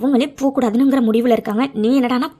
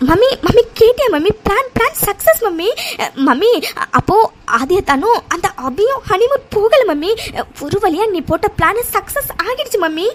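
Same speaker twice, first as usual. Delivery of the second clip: excited shouting; 9 semitones higher than usual. Acted. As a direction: blurt out the good news at the top of your voice. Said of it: "கத்தாதடி".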